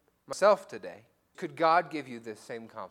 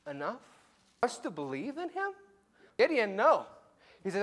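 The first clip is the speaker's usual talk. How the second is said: usual speaking voice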